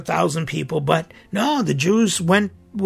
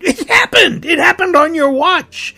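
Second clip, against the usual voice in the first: anguished cry; 8 semitones higher, 4 dB louder than usual. Real, not acted. Priest confronting speakers at the assembly, anguished cry. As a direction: voice cracking high and loud with grief.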